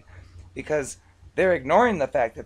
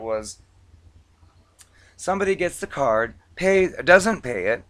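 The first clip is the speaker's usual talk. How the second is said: normal talking voice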